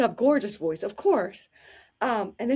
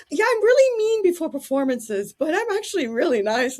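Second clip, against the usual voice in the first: high, loud, bright gushing confession; sweet diva; 7 semitones higher, 7 dB louder than usual. Retold or imitated. Diva explaining herself to the fan, high and gushing. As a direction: gush it like a friendly star. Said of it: "And she goes".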